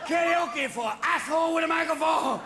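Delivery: put-on slow, high, bellowed karaoke in Japanese accent; drunk Japanese karaoke singer. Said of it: "after five Jack Daniels".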